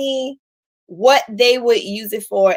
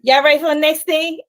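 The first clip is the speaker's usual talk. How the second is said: usual speaking voice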